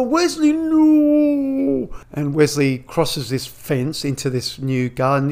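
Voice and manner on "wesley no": very high, louder mock cry of alarm; high-pitched hammed-up panic. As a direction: yelp a warning in a squeaky voice. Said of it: "and they go".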